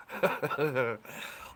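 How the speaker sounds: short, real chuckle; amused chuckle. Real, not acted. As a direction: chuckle at a viewer's joke. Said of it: "you're a funny guy".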